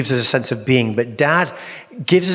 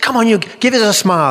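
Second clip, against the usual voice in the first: higher, louder cheerful coaxing; coaxing a child; 9 semitones higher, 4 dB louder than usual. Retold or imitated. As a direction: urge the boy to smile for the photo. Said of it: "I said".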